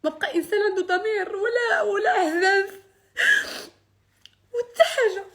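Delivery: high, loud voice breaking in tears; sobbing out the grievance; crying. Real, not acted. Breaks down over injustice.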